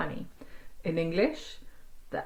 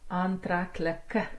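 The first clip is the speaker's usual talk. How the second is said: slow stressed ending; slower than usual. Shown as a demonstration. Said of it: "Can you see there?".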